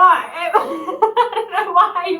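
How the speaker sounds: loud, high, laugh-filled words; laughing while talking. Real, not acted. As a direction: crack up as you say it. Cracking up retelling the prank.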